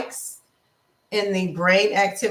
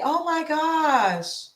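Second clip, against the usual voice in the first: sweet well-wishing; 5 semitones higher than usual. Shown as a demonstration. Sugary kind voice to a rude driver. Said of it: "taking that energy and saying".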